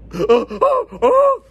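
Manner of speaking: high, loud gasping yelps; imitated nightmare cries. Cellmate's noises twenty minutes later, high mock yelps. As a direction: mimic a sleeper crying out. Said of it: "making these noises".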